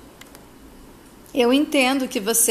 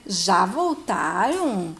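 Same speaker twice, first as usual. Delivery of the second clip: surprised; 5 semitones lower, much slower than usual. Acted. Silvia sees couple return early.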